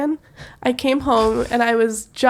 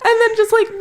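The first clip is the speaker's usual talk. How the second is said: laughing; 11 semitones higher, 4 dB louder than usual. Real, not acted. Laughing while explaining.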